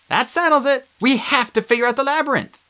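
a lightly voiced worried girl; Annabeth's voice. A narrator's reading. Narrator voicing Annabeth fretting over the dead.

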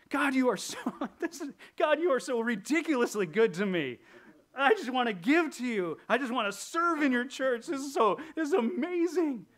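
high, loud, breathless gushing; gushing giver. Acted. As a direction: ham up a starry-eyed worshipper. Mocking an over-eager giver praying.

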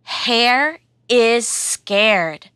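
slow title read. Shown as a demonstration. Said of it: "This story is called".

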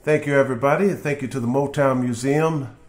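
ordinary delivery, nothing marked

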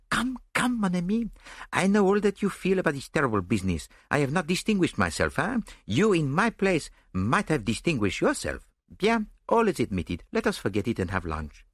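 animated, consoling little detective; genial foreign detective, coaxing. A narrator's reading. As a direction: coax your friend out of his doubts.

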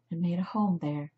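ordinary delivery

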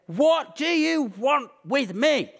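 high, slow, drawn-out mock yell; retold shout. Possessed man confronting Jesus, mock shouting. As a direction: wail it out, imitating a raging man. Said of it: "shouts at Jesus and he says".

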